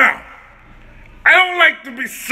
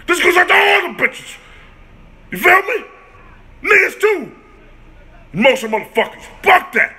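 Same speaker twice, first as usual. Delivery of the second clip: shouting rant; 4 semitones higher than usual. Real, not acted. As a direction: shout him down, fed up.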